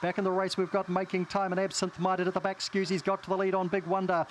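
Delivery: fast live race commentary; race call. Acted. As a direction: call the race at full pace.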